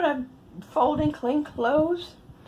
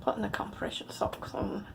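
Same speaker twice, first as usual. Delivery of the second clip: whispered, out of energy; barely a breath, worn out; softer, drained whisper after the socks; exhausted whisper; 4 semitones lower, 13 dB quieter than usual. Real, not acted. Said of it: "a lot of my energy was taken".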